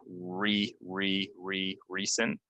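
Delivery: "re, re, re, recent" with a long ee; long E drill. Shown as a demonstration. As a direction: repeat REE slowly, then the word "recent". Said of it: "that's a E".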